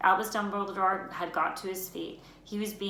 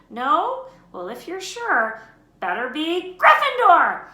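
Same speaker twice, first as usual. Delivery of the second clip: higher and much louder, slowing into the final shout; hat shouting; 10 semitones higher, 12 dB louder, slower than usual. Acted. The Sorting Hat deciding and shouting Harry's house to the hall. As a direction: build to a triumphant call. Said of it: "heard the hat shout the last word".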